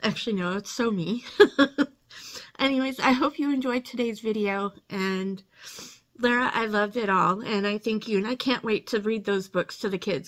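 ordinary delivery

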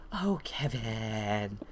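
long drawn-out mock swoon; slow, hammed-up imitation of her; exaggerated teacher voice. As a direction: stretch the name out suggestively. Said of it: "She really didn't say that like that".

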